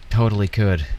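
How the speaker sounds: ordinary delivery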